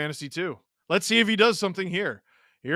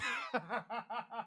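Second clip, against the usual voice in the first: quiet run of chuckles; soft laugh; 5 semitones higher, 17 dB quieter than usual. Real, not acted. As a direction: laugh softly at your own mistake. Laughs at own mistaken goal call.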